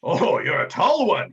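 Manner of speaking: slow, loud captain voice; Captain Tumult Viral. Acted. Captain looks up at a tall adventurer, loud drawn-out marvel. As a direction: marvel loudly, drawing the words out. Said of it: "He looks up at you".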